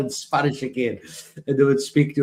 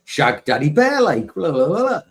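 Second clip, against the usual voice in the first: loud Geordie footballer impression; Geordie accent; 5 semitones higher, 6 dB louder than usual. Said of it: "he would go like".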